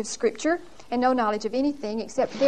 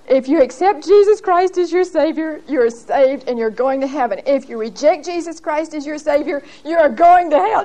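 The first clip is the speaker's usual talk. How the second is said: blurting it out; 4 semitones higher, 5 dB louder than usual. Retold or imitated. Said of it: "she blurts out".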